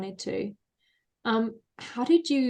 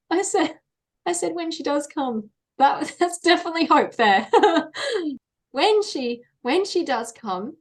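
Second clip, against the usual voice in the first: high pitched laughing through words; giggling at her own slip; 8 semitones higher, 6 dB louder than usual. Real, not acted.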